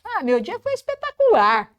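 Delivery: voz bem aguda e animada; entusiasmado. Shown as a demonstration. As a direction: celebre o dia com gosto. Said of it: "Olha que gostoso falar isso". (com entusiasmo, saboreando a palavra)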